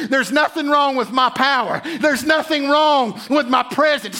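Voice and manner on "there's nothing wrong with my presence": preached shout. Retold or imitated. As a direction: proclaim it as God with full force. God speaking, building to shout.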